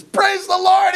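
high, jubilant victory cry; triumphant shout. Acted. David showing the head to his brothers, triumphant.

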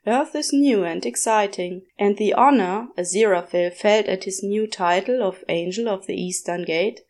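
plain reading voice

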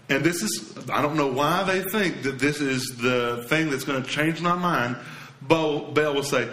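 ordinary talk, nothing marked

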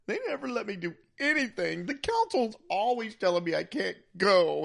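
put-on high-pitched, babyish whine; mocking, high whiny complaint; whiny Anakin impression. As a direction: whine it like a petulant, babyish kid. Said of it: "he's just too whiny and babyish".

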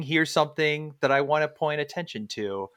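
normal talking voice

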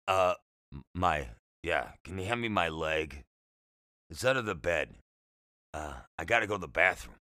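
low, halting, slurred patient; raspy, slurred voice. A narrator's reading. Patient explaining his odd request to the boy, raspy and slurred. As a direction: stumble through it low and raspy. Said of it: "He spoke with a raspy, slurred voice".

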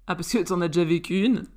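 plain reading voice

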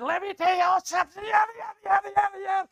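loud, high mock preacher harangue; hammed-up rapid-fire speechifying; mock fiery speech. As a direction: shout it like a fired-up debater. Preacher mimics a fiery speaker.

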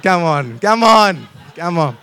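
loud "come on"s, peaking high on the middle one; loud goading. Real, not acted. Preacher prods congregation that missed his joke, loud goading. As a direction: goad the crowd, raising your voice.

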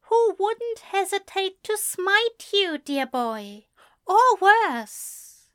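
high, earnest pleading; pleading angel. A narrator's reading. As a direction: plead with him. Aziraphale warns.

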